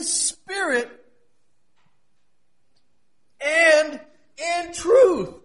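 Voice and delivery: ringing pulpit proclamation; impassioned preaching. Real, not acted. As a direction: preach it high with drawn-out emphasis. Preaches at full force.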